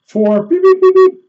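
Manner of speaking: strained high mouth beeps; imitated beeps. Shown as a demonstration. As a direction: mimic the pulses, racing. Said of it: "I can't even do it with my mouth".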